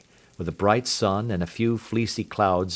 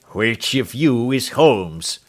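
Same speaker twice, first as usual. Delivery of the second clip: gruff visitor; 5 dB louder, slower than usual. Acted. Angry intruder confronts Holmes.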